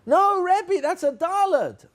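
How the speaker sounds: high, loud protest of pupils; children correcting teacher. Pretend. Children correcting their Rebbe's mistake, high drawn-out call. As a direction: cry it out like kids catching a mistake. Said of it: "What are the kids gonna say?".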